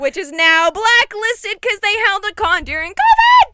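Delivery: loud, high outraged call-out; indignant shout. Real, not acted. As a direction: yell it like a scandal. Indignant outburst about the con.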